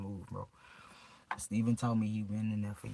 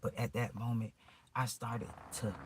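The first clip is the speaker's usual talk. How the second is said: normal talking voice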